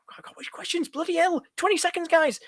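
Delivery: very high, strained, overwhelmed; real fluster, voice jumping up high; flustered panic. Real, not acted. Streamer swamped by questions as time runs out, flustered panic. Flooded with questions seconds before deadline.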